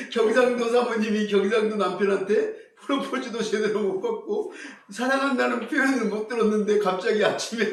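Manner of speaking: normal talking voice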